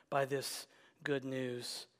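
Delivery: ordinary delivery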